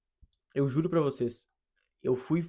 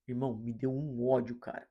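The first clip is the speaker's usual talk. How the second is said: ordinary talk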